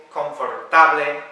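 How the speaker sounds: "confortable" read as spelt, Spanish-style; mispronunciation demo. Shown as a demonstration. Teacher showing a second wrong version, mispronounced. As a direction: say "confortable" with every vowel sounded and a Spanish ending. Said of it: "It's not".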